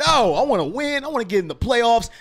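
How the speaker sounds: high, loud pumped-up yelling; slow, punchy shots of hype as the game starts; fired-up fan. Retold or imitated. Fan acting out his game-time self, shouting high and loud. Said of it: "because I get emotional".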